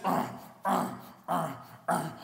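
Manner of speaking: repeated short effort grunts; acted-out straining grunts; paddling grunts. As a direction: grunt with each backwards stroke. Acts out paddling backwards.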